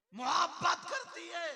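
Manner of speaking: the last words flung out high, loud and slow; preaching shout. Acted. Preacher hammers home to children that mothers love them, high slow shout. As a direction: cry it out like a fiery preacher.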